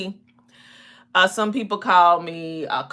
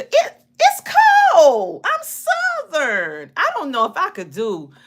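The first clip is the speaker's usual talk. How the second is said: playful protest; 7 semitones higher, 5 dB louder than usual. Real, not acted. Southerner turns down a trip to freezing Alaska, playful protest. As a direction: balk loudly with stretched, swooping words.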